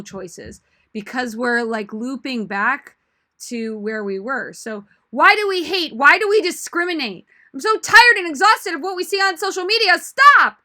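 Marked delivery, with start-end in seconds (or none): 5.1-10.6 high, loud impassioned speech building to a shout; rehearsed outcry, swelling louder and higher to the end; crescendo. Shown as a demonstration. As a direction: build from loud protest to a yelled "Stop!".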